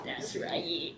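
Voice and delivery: breathy whispered agreement; just breathe it. Real, not acted.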